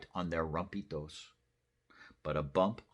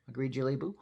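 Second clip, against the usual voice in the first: plain reading voice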